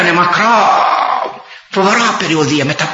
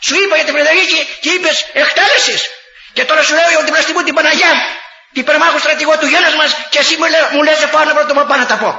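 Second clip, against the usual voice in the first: indignant rebuke; 10 semitones higher, faster than usual. Retold or imitated. Priest scolding the chief of staff.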